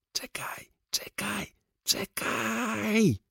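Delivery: high strained squeak, last word drawn out; urgent hushed warning. Acted. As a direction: hold him back in a high, stifled voice. (wysokie, zduszone, przeciągane ostrzeżenie)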